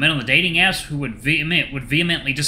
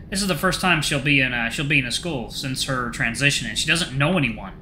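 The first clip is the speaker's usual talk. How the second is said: usual speaking voice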